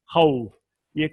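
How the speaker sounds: usual speaking voice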